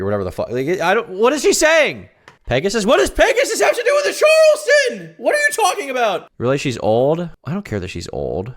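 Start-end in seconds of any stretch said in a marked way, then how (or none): 1.1-2.8 loud, high cry of bafflement; baffled outburst. Real, not acted. Baffled by the clip. 2.8-6.3 very high, loud incredulous shout; exasperated shouting. Real, not acted. Yelling at absurd chat message.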